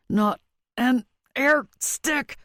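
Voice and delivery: slow, high, through clenched teeth; gritted teeth. A narrator's reading. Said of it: "between gritted teeth".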